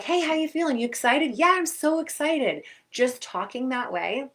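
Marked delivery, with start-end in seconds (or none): 0.0-1.4 higher, peppy check-in; a coach hyping the athlete up; upbeat coach. Retold or imitated. A coach checking on the athlete before competing, peppy. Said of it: "your coach is like". 1.4-2.6 higher, louder eager yes; excited reply. Retold or imitated. An athlete answering the coach with eager excitement. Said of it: "your coach is like".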